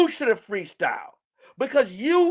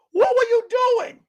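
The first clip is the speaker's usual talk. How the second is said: high loud demand; 11 semitones higher, 4 dB louder than usual. Real, not acted. Rhetorical cry at Cam.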